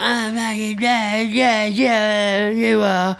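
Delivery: high, drawn-out mocking wail; slurred, garbled mimicry of a drunk arguing back. Drunk girlfriend mocking her boyfriend in a row, high mocking wail. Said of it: "What, mocking him?".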